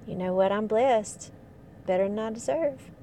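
friendly Southern US accent greeting. Shown as a demonstration. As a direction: put on a folksy Southern accent. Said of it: "slip into the Southern accent".

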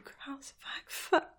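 breathy whisper of pleasure. Acted. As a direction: drop to a whisper, lost in it. Hushed moment during sex scene.

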